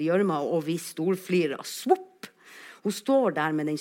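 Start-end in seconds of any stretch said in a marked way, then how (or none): 1.6-2.3 high, short suction pop; imitated boot squelch. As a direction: mimic a boot coming out of mud. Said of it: "det lager så artig lyd". (høyt svupp som fra gjørma)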